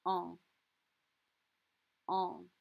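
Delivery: "Un" as one nasal vowel. Shown as a demonstration. Teacher repeating the nasal vowel for students. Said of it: "is a nasal sound".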